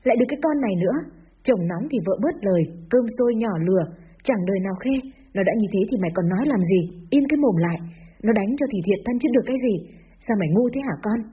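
plain reading voice

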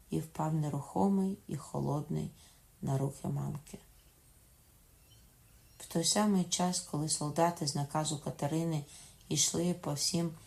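ordinary delivery, nothing marked

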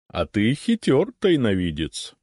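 slow, knowing chuckle; sly smirk. A narrator's reading. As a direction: tease him with a wry grin. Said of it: "усмехнулась средняя голова стража".

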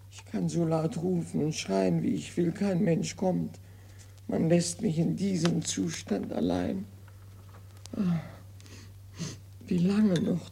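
put-on fussy old invalid grumbling over his bills; Molière's imaginary invalid, complaining to himself; hypochondriac Argan. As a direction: play the petty, self-pitying patient. Acts Argan's monologue.

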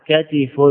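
كتف as ka-ti-fun; three-syllable كتف: ka-TI-fun; fatha then kasra. Shown as a demonstration. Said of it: "بفتح الفاء وكسر العين".

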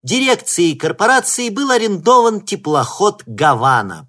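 loud, high, ceremonial announcer voice; solemn speech. Acted. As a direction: proclaim it like a host on stage. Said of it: "продолжала Белохвостикова".

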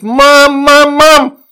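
very high, loud cries; child calling mum. A narrator's reading. Frightened girl calls mother for help, screaming.

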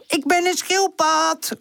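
high, drawn-out silly announcement; comical call. A narrator's reading. Charlie playing turtle under the basket, comical. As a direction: call it out high and stretched, clowning. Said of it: "roept hij grappig".

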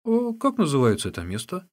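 naive, put-on puzzlement; feigned simpleton. A narrator's reading. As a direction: play the clueless simpleton asking. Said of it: "Прикинувшись простачком".

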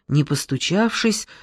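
ordinary delivery, nothing marked

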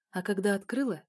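ordinary delivery, nothing marked

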